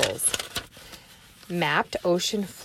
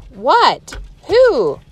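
higher and louder sudden questions; startled Annie; 6 semitones higher, 8 dB louder than usual. Acted. Teacher voicing Annie, spooked by the eyes. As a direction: blurt it out, spooked.

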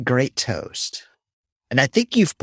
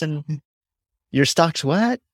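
usual speaking voice

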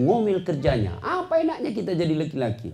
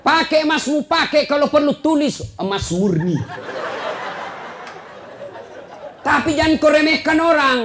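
high, insistent crowd-rousing delivery; rousing preaching; 8 semitones higher than usual. Real, not acted. Preacher urging congregation to wear their finery, rousing and high. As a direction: punch out each permission in a raised voice.

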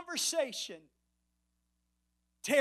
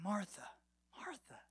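hushed, tender endearment; very gentle, quiet calling of a friend's name; Jesus; 4 semitones lower, 14 dB quieter than usual. Acted. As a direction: say the name softly and fondly, pausing between. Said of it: "it was in a tone of endearment".

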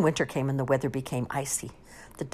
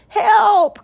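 high, loud, drawn-out cry; long storybook shout of alarm; crying for help; 14 dB louder than usual. A narrator's reading. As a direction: yell as you fall. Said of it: "cried Bear as he tumbled".

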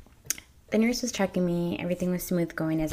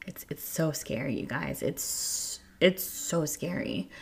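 choked up; 2 semitones lower, 5 dB quieter, slower than usual. Real, not acted. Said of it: "sorry, I get so emotional".

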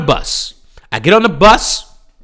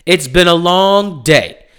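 ordinary talk

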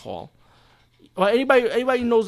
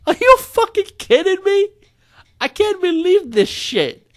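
high, loud, slowing disbelief yell; exasperated yell; 8 dB louder than usual. Retold or imitated. Reviewer mimicking his own outraged reaction to the dead-panda scene, high loud yell. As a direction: shriek your disbelief at the screen. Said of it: "I'm like".

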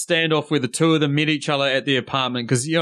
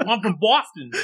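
higher-pitched put-on Boston accent; 6 semitones higher than usual. Co-host jokes about being predictable, mock Boston accent. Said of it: "in your best Boston accent".

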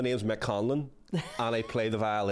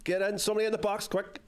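higher, urgent calls at the screen; will the striker on, pitch up; excited urging; 8 semitones higher than usual. Real, not acted. Gamer urging his striker on, excited calls.

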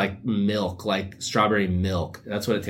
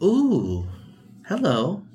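mock dreamy greeting; 9 semitones higher, much slower than usual. Waking up and greeting the sunlight in a dreamy voice. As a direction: greet the sun like a dreamy romantic. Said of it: "You're like".

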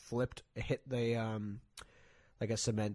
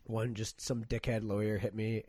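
ordinary talk, nothing marked

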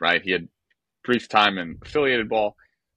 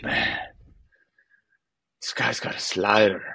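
imitating a teammate's Italian-accented English. Teammate to the narrator at the water cooler, in an Italian accent. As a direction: put on a light Italian accent. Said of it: "in, like, this Italian accent".

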